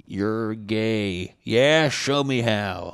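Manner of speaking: lightly voiced mock banter; put-on voice. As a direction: drag the comeback out teasingly.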